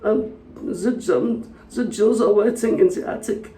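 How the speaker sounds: high mock German accent. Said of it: "A Russian talking to a German".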